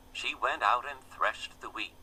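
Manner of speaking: usual speaking voice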